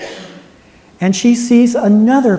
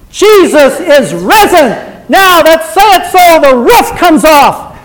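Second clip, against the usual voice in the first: loud, high, ringing sermon voice; preaching at full force; 5 semitones higher, 7 dB louder than usual. Acted. Preacher urging the congregation to spread the news, preaching loud. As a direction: rouse the church, full throat. Preacher leading call and response.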